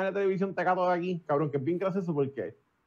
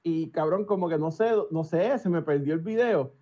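ordinary talk

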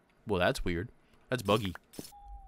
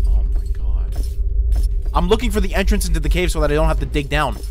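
loud, shaken; 3 semitones lower, 8 dB louder than usual. Real, not acted. Loud after escape.

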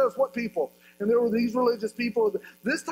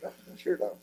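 a low, soft, sheepish little answer; sheepish reply; 6 semitones lower, 7 dB quieter than usual. Retold or imitated. Zacchaeus caught in the tree answers Jesus, low and sheepish. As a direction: mumble it like you've been caught.

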